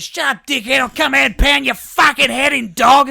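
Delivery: high, loud tradie yelling from a ute; angry shout. Retold or imitated. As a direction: scream a threat out the car window. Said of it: "calls out and goes".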